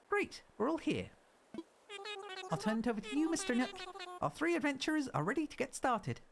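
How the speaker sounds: bright, high and eager assistant; high cheerful helper voice. Pretend. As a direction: read it like a chirpy island assistant. Reads helper dialogue in voice.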